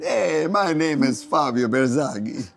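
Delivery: imitating an Italian caller, drawn out; Italian accent. Italian colleague introducing himself on a phone call, imitated Italian accent. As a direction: put on an Italian accent for a slow self-introduction. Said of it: "He says, in his Italian accent".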